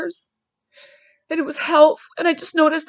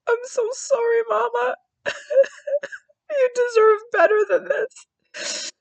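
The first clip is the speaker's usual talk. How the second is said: crying; 9 semitones higher than usual. Real, not acted. Crying while retelling her apology.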